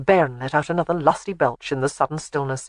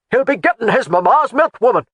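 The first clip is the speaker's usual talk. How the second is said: high, loud angry retort; 6 semitones higher, 6 dB louder than usual. A narrator's reading. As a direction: fire it back at her. Laird argues with Jamie.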